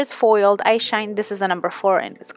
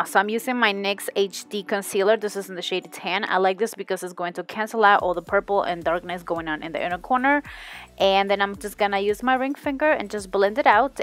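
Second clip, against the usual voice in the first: ordinary talk, nothing marked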